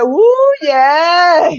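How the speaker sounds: high, loud cheering woo yes; cheering a viewer's comment aloud; excited woo. Retold or imitated. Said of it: "Brandy said".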